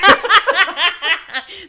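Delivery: high, loud helpless laugh; a loud laughing fit; loud burst of laughter. Real, not acted. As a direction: crack up loudly. Laughing at gynecologist joke.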